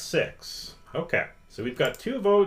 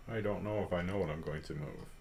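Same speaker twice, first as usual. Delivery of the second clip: fast, much softer low mutter; muttering to himself; 6 semitones lower, 9 dB quieter, much faster than usual. Real, not acted. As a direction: mumble it under your breath, quickly. Muttered to himself right after.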